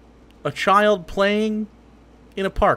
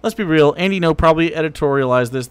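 ordinary talk, nothing marked

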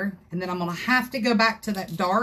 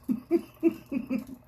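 a short, light giggle; 6 semitones higher than usual. Real, not acted. Crafter joking about her angry husband, giggling. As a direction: laugh at her own joke.

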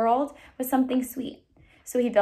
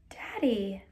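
whispered plea to her father; 2 semitones lower, 5 dB quieter than usual. Acted. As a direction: breathe the word, shaken.

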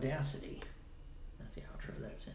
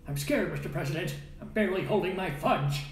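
a slightly raised, mock-frightened movie quote; quoting a movie line; 4 semitones higher, 4 dB louder than usual. Quoting Buckaroo Banzai to stream viewers, mock scared. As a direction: play it like a scared aide to the president. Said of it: "one of my favorite lines from Buckaroo Banzai".

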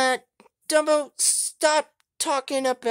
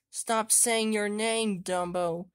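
ordinary delivery

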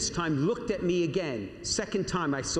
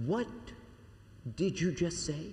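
retelling her words in a hushed, softer voice; a stunned, hushed question; barely whispered; 6 dB quieter than usual. Retold or imitated. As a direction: hush it as if stunned. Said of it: "She barely whispered".

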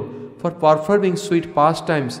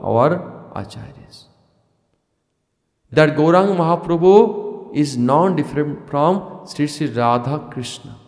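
ordinary talk, nothing marked

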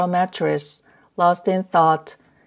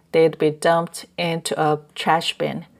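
usual speaking voice

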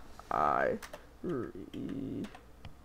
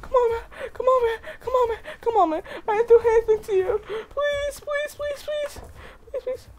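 high, sobbing pleas, fading softer; crying; 5 dB quieter than usual. Acted. Beaten dinosaur begs its attacker while crying, high and sobbing. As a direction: beg through tears, high and fading. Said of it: "I'm crying".

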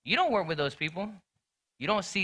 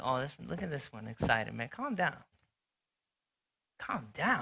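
softer, mock-jealous grumbling; muttered grumbling; 3 semitones lower, 6 dB quieter than usual. Jealous churchgoer hating on an excited brother, soft grumbling. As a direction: grumble under your breath about the eager one. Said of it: "Be like".

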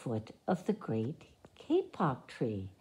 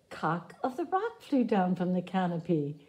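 ordinary delivery, nothing marked

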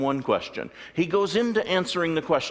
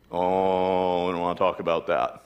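embarrassed; 8 semitones lower than usual. Retold or imitated. Embarrassed followers after false prophet.

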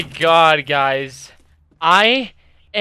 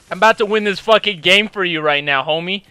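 ordinary talk, nothing marked

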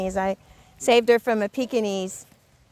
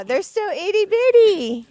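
cooing; 7 semitones higher, 4 dB louder than usual. Real, not acted. Cooing over tiny dogs.

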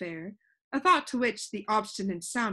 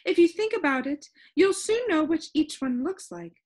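high-pitched, lively storybook shopkeeper; high voice of a proud expert; Soundkeeper character; 6 semitones higher, 4 dB louder than usual. A narrator's reading. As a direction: explain it eagerly in a high character voice.

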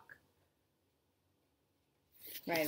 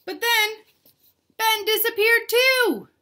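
dramatic reveal; 13 semitones higher, 10 dB louder than usual. A narrator's reading. Reader revealing to children that Ben vanished. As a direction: make the kids gasp. Dramatic story twist for kids.

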